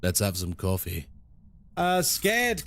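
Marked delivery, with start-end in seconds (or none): 0.0-1.1 low, smooth radio-host voice; deep firm voice. Pretend. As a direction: keep the deep announcer voice going. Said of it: "This is actually my real voice".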